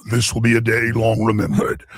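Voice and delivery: lightly voiced dark lord; a touch of Darth Vader gravity; hint of a movie villain. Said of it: "this is the Death Star".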